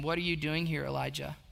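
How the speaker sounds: soft, low question just above a whisper; gentle voice of God. Acted. God's voice to Elijah at the cave, gentle and hushed. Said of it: "came a gentle whisper".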